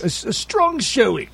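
high, drawn-out, badly faked British accent; worst British accent. Host attempts UK slang for the caller, terrible British accent. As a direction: overdo a slow, high-pitched fake UK accent. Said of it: "That's my worst accent ever".

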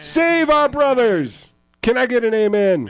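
high, stretched revival cry; mock preaching. Preacher asking co-hosts for an amen, mock revival fervour. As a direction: call to the congregation at full fervour.

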